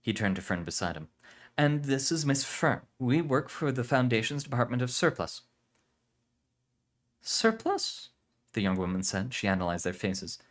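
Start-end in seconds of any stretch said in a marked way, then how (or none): 1.6-5.4 put-on a touch higher, earnest young agent; lightly voiced man. Ekro speaking to hosts. 7.2-8.1 put-on much higher, puzzled young woman. Aurelia questions.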